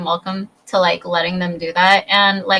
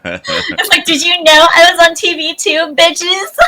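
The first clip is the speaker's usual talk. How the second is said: much higher, hammed-up diva voice through laughter; laughing diva boast; 8 semitones higher, 3 dB louder than usual. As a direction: brag like a cheeky star, laughing.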